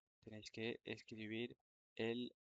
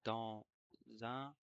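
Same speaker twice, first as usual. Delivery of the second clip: dictating. Shown as a demonstration. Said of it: "je vais dicter une dictée".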